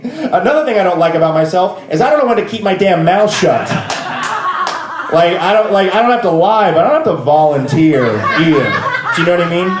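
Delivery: talking through real laughter; laughing. Real, not acted. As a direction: laugh at yourself while confessing. Laughing at own confession.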